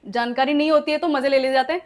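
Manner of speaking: ordinary talk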